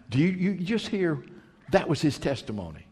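ordinary talk, nothing marked

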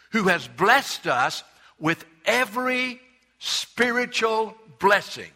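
"every" drawn out slow and high; stress on every. Shown as a demonstration. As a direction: lean hard on "every", stretch it. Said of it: "you need to look up that word "every".

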